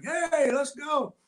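high, slow crowd shout; crowd cheering. Retold or imitated. Onlookers outside the bar urging the brawl, high shout. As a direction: call out like bystanders egging on.

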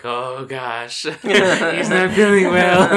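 loud, high laughing retelling; mock concern, loud and slow with laughter; laughing mock dismay. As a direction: laugh through a drawn-out worried realisation. Retold alarm, laughing through it.

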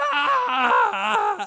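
very high agonized yell; pained scream. Pretend. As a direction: scream in pain. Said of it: "making him go".

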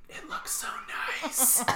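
whispered, then a high squeak on nice; hushed longing aside; breathy whisper. Retold or imitated. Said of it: "she goes".

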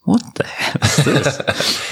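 high, drawn-out whisper of disbelief; mock-appalled whisper. Imitating a Japanese wife shown canned spaghetti, appalled whisper. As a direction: whisper it slowly, imitating someone aghast at the food. Voicing Japanese wife's shocked reaction.

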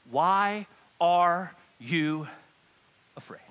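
very slow, word by word; measured, weighty question; slow, deliberate. Acted. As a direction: let each word land with a pause. Jesus answers, word by word.

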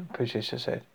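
ordinary delivery, nothing marked

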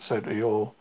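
usual speaking voice

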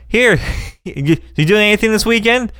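hyper server. Retold or imitated. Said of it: "very hyper".

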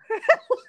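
short loud laugh; high burst of laughter. Real, not acted. Laughs at friend's remark.

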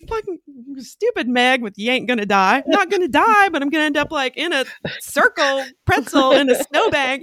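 high-pitched comic rant; hammed-up indignant griping; mock exasperation. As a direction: fume about the friend who got you here. Retold fuming at the captain.

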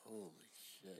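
softly spoken, drained reaction; retold hushed disbelief. Medic recalling the moment it is over, quiet disbelief.

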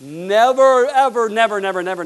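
fiery preacher crescendo; preaching shout. Real, not acted. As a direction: shout the nevers at the congregation. Preacher shouting never again.

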